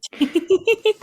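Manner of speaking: high squealing laugh; bright surprised giggle; burst of laughter. Real, not acted. Laughing at the movie idea.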